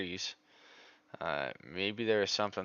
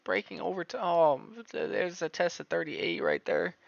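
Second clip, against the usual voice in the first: higher pitched, whining lament; lingering whine over the chart; whiny; 8 semitones higher than usual. Real, not acted. Trader keeps whining about the missed breakout. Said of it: "I'm sounding like a little whiny girl".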